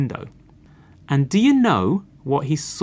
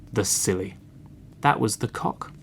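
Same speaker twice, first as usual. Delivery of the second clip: ordinary delivery, nothing marked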